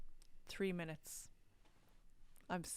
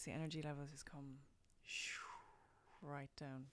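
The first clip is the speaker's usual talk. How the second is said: voice dropping low; 3 semitones lower, 8 dB quieter than usual. Shown as a demonstration. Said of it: "you can hear it in the pitch in my voice".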